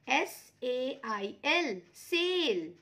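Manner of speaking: slow letter by letter, then सेल; spelling out slowly. Shown as a demonstration. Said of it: "यहाँ पे देखिए". (बच्चों के लिए धीरे-धीरे अक्षर बोलना)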